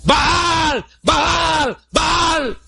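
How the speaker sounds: high-pitched mock cries, long vowels; imitated frenzied shouting. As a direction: imitate worshippers crying out to their god. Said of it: "con más ímpetu gritaban".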